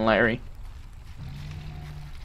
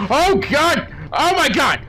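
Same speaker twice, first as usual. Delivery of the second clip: panicked screams; 6 semitones higher, slower than usual. Real, not acted. Character dying in game.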